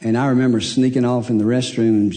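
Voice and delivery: ordinary talk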